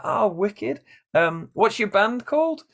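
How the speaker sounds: very high, bright and chatty; Luke's eager voice. Retold or imitated. Luke asking the stranger about his band, high bright voice. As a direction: ask it like a friendly fan.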